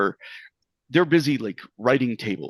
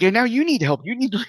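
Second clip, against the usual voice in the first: put-on high, loud panicky child; frantic child, pitched way up; rising panic; 11 semitones higher, 4 dB louder than usual. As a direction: order them about as alarm builds. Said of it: "gradually rising in panic".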